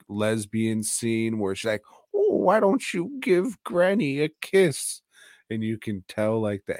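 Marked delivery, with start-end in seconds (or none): 2.1-5.0 high, creepy-coaxing granny voice; hammed-up grandma wheedling for a kiss; mock granny. Movie grandma asking girl for a kiss, mock granny. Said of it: "the grandma".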